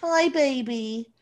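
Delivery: slow sweet hello to the cat. Real, not acted. Greeting the cat Frisk.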